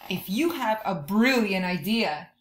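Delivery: normal talking voice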